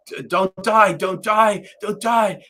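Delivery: loud, high-pitched imitation of frantic pleading; retold screaming plea. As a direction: plead urgently as the panicked friend. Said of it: "he's screaming and he's crying".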